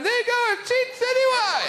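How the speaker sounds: high shrieking mock commentator; mock video voice. Bystander filming the bungee jump, high mock shriek. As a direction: yell it like a giddy bystander filming. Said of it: "Whoever's doing the video's going".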